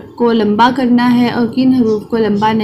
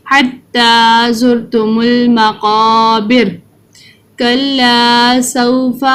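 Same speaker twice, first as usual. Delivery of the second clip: slow, drawn-out tajweed recitation; Quran recitation; much slower than usual. Shown as a demonstration. Teacher reciting the surah for children to follow, slow chanted recitation. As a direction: chant each word slowly for children to repeat.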